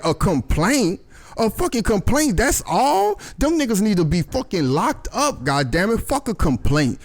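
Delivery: high, incredulous retold protest; indignant disbelief. Retelling Eddie balking at a mere complaint, indignant.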